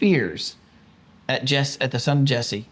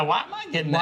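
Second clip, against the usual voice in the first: higher, laughing mock complaint; Saul's wife protests the blame, laughing; 9 semitones higher, 3 dB quieter, much faster than usual. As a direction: play the wronged wife through a laugh. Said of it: "Saul's wife's like".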